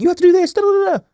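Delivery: high-pitched, rapid nagging squeal; frantic demanding. Retold or imitated. Husband mimics himself losing his center, frantic and high-pitched. Acts out own panicked nagging.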